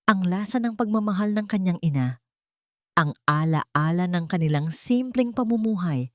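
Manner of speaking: usual speaking voice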